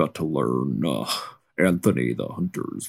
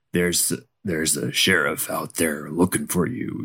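ordinary talk, nothing marked

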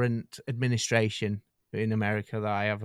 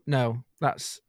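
normal talking voice

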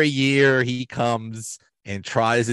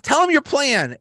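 very high, chirpy command; imitating Michael; 3 dB louder than usual. Michael putting Oscar on the spot, squeaky high. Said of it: "He's like".

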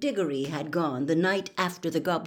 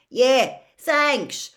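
loud, raised offhand call; shouted; 6 semitones higher, 8 dB louder than usual. A narrator's reading. Ron shouting back to the fat lady, raised call. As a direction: shout it back over your shoulder. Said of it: "Ron shouted over his shoulder".

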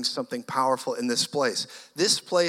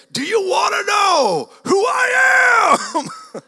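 much higher, louder, slow roar; roaring shout; 7 dB louder, slower than usual. Retold or imitated. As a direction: bellow it to silence the room. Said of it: "I heard myself roar out".

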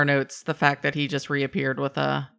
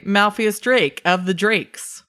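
slow, higher, deliberate self-introduction; Malpheus in character; 6 semitones higher, 4 dB louder, slower than usual. Acted. Malpheus introducing himself to a kobold, slow and deliberate. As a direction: announce your name grandly and slowly. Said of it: "point at myself and say".

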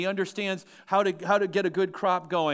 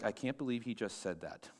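low, very soft aside; hushed mock disbelief; 8 semitones lower, 12 dB quieter than usual. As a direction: murmur it like a scandalized listener in the pews. Voices shocked listeners' private reaction.

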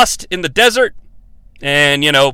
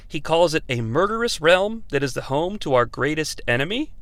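usual speaking voice

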